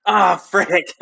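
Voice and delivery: real outburst, high and loud; loud dismay. Real, not acted. Game master reacting to the bad roll, loud dismay. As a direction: cry out at bad luck, breaking into laughter.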